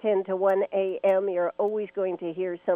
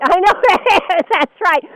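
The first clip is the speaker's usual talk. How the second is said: laughing; 9 semitones higher, 9 dB louder than usual. Real, not acted. Laughing agreement.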